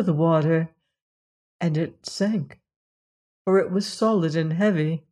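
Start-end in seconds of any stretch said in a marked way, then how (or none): none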